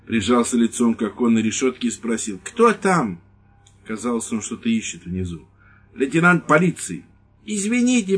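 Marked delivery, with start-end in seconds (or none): 2.4-3.2 high, loud nervous question; wary old priest. Acted. Priest asking the night visitor who's there, high and nervous. Padre asks who knocks. 6.0-7.1 curt announcement. Acted. Lieutenant announces himself. 7.5-8.2 high, loud squeak; squeaky apology. Acted. Priest squeaking an apology to the lieutenant, high and loud. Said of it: "пропищал падре Хосе".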